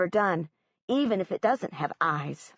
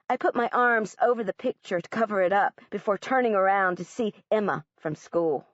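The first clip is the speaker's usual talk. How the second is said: plain reading voice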